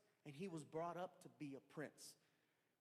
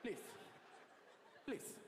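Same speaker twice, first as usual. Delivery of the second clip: man in suit; 4 dB quieter, slower than usual. Retold or imitated. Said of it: "his favorite word was".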